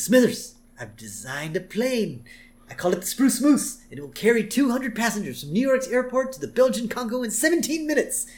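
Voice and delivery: high-pitched, far-gone schemer; Mister Burns. Retold or imitated. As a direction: announce a mad invention with grand pride. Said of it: "we cut back to Mister Burns".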